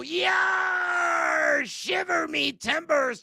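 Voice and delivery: lightly hammed pirate line. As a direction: toss off a pirate catchphrase. Pirate catchphrase again.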